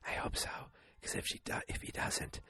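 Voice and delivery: barely-there, whispered reply; voiceless whisper. Retold or imitated. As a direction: imitate a mom with no voice. Mimics mother who lost her voice.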